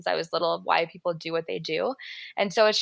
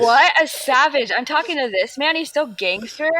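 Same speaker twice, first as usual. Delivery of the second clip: impressed; 6 semitones higher, 6 dB louder than usual. Real, not acted. Admiring beard photo.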